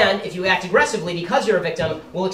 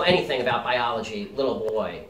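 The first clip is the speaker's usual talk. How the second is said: lowered voice imitating Zoe; deep-voiced Zoe; 6 semitones lower, 4 dB quieter than usual. Imitating Zoe mocking the speaker on TV, lowered voice. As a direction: drop the pitch to mock a deep voice. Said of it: "in a voice at least an octave lower than mine".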